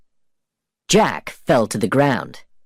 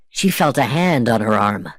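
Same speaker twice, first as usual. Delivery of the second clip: plain reading voice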